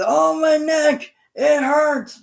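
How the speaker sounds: high, loud, slow whine of fake pain; faking neck pain. Miz pretending his neck hurts, hammed-up high whine. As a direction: ham up a wrestler pretending to be hurt. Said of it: "and now he says".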